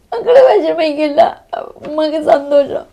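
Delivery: real tears breaking through loud, pleading words; crying. Real, not acted. Mother talking to her dead son, crying aloud.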